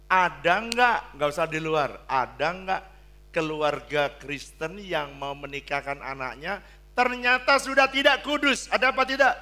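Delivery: loud, high pulpit challenge; preaching at full force. Acted. Preacher challenging the congregation, loud and high. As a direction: demand an answer from the pews.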